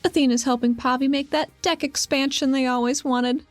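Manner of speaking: usual speaking voice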